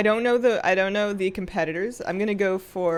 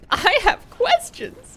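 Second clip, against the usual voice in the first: slow, high and louder; drawn out, comically baffled; mock bewildered; 7 dB louder, much slower than usual. Real, not acted. Streamer baffled about snails, slow and high.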